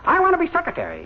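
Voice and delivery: very high, quick bird voice; squeaky-high, hurried little bird; piping little cuckoo. Acted. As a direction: pipe up as a tiny cuckoo. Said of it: "the little cuckoo piped up".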